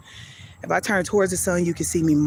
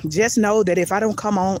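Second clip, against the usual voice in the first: ordinary talk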